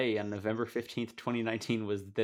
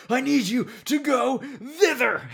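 high, loud, laughing mock rage at home; mock angry yell; 8 dB louder, slower than usual. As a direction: shout it like a furious parent, cracking up. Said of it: "imagine being angry and coming home".

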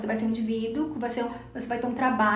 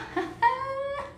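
high nervous laugh; 13 semitones higher, 4 dB quieter than usual. Real, not acted. Nervous laugh after scary theory.

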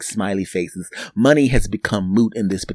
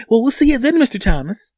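high teller voice; 13 semitones higher, 4 dB louder than usual. Retold or imitated. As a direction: play the chirpy teller. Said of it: "teller voice".

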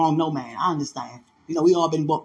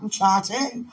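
ordinary delivery

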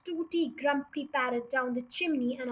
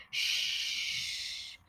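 soft, drawn-out shush; long hushing shh; 8 dB quieter than usual. Acted. Matilda silencing the family to listen, long soft shush. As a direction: hush them with a long breath.

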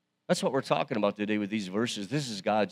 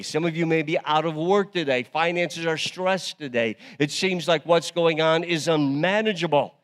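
louder, higher preaching that builds; rising sermon; 4 semitones higher, 6 dB louder than usual. Acted. Pastor stirring a worried congregation, louder preaching. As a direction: build the urgency for people in trouble.

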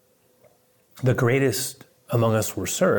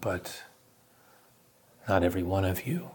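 quiet, ominous turn; hushed warning after a pause; 3 semitones lower, 7 dB quieter than usual. Retold or imitated. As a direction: drop softer and let it hang ominously. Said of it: "but then a turn, ominously".

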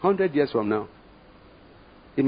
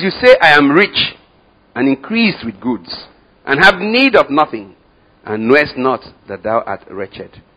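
loud, slow proclamation, higher; booming scripture; 4 semitones higher, 10 dB louder, slower than usual. Acted. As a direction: thunder the verse slowly. Preacher quotes Laodicea rebuke.

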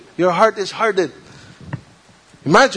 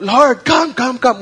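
higher, louder frightened plea; desperate cry; 7 semitones higher, 4 dB louder than usual. Retold or imitated. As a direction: cry out to be saved from the storm. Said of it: "they cried out".

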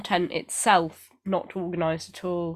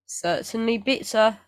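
put-on New Zealand accent; 3 semitones higher than usual. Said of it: "Don't know why I did a New Zealand accent then".

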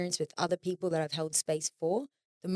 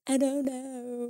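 higher, slow, mumbled I don't know; reluctant shrug; 6 semitones higher, much slower than usual. Retold or imitated. Someone asked for the hundredth time, slow and reluctant. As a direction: drag it out like a reluctant teen. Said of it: "it's probably like".

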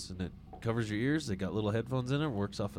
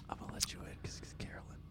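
conspiratorial whisper; 13 dB quieter, faster than usual. Pretend. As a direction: whisper it like a secret. Whispered aside about judge.

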